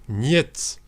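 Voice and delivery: usual speaking voice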